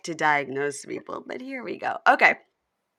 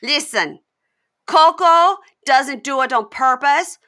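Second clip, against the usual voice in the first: imitating Jasmine; 6 semitones higher, 7 dB louder, slower than usual. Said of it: "And she goes".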